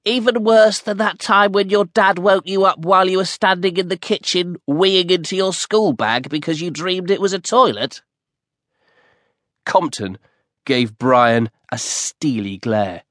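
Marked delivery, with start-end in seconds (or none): 0.1-8.0 put-on higher, distinct boy voice, teasing; cheeky best friend. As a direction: needle your friend with a cheeky reminder. Brian's voice, teasing question.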